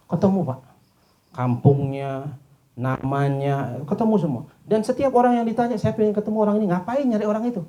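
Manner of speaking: climbing high and loud, storyteller building; rising excitement. A narrator's reading. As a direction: push the story up to a peak. Preacher building to excited climax. (semakin bersemangat dan keras)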